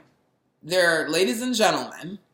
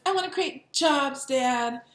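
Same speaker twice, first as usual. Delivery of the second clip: daughter impression; 6 semitones higher, slower than usual. Imitating Trump's daughter.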